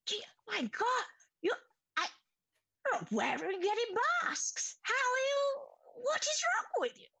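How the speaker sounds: a high-pitched shocked alchemist; alarmed masked inventor. Acted. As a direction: squeal it out in alarm. GM voices alarmed NPC.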